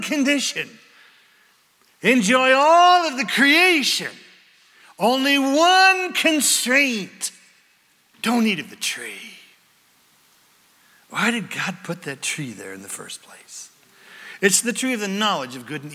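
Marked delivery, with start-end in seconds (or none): 2.0-7.3 higher, louder, slow declaration; emphatic proclamation. Acted. Preacher booming God's one condition, slow and loud. 11.1-13.7 softer, hushed question; whispered question. Real, not acted. Preacher whispering a mystery to the congregation.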